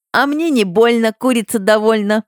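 high singsong teasing; childish taunt. A narrator's reading.

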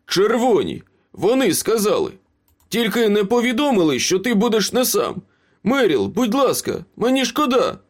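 high-pitched, frantic excuses; desperate pleading. A narrator's reading. Cornered Ace explains and apologises to Meryl, pleading.

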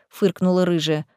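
ordinary delivery

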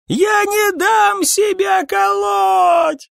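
very high, long-drawn shriek; shrill screaming. A narrator's reading. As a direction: scream your refusal, stretching every word. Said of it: "доносилось с визгливым эхом". (визгливый протяжный крик)

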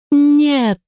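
high, drawn-out cry of refusal; scream. A narrator's reading. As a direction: wail it out high and desperate. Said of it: "заорала я".

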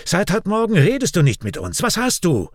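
high-pitched, impatient questioning; irritated. A narrator's reading. Raik confronting silent Kian at work, irritated. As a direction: demand an answer, annoyed. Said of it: "fragt Raik gereizt".

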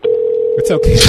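usual speaking voice

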